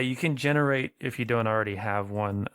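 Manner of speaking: normal talking voice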